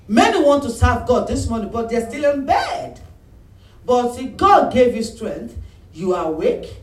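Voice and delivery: loud, high rousing preach; full-force preaching. Real, not acted. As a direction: fire up the congregation. Preaching to congregation.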